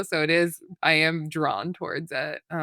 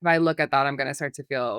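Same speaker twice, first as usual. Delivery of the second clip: ordinary talk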